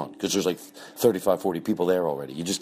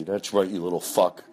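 ordinary talk

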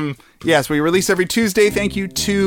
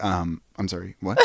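confused quiet server; 9 semitones lower, 10 dB quieter than usual. Retold or imitated. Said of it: "And then you'd be like".

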